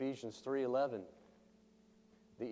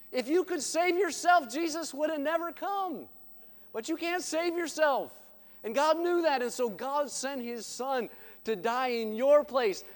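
much higher and louder, climbing to the cross; impassioned preaching; 8 semitones higher, 6 dB louder than usual. Acted. Evangelist urging listeners that only Jesus saves, impassioned preaching. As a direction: lift it high and hammer each line.

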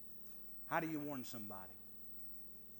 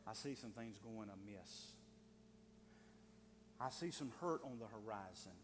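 quiet concern; 6 semitones lower, 6 dB quieter than usual. Acted. Modelled concerned warning.

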